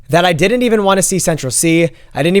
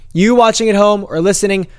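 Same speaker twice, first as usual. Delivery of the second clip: normal talking voice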